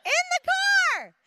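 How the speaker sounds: high, loud comic shriek; hammed-up yelling, last word stretched; mock screaming. Comic re-enactment of her own scream.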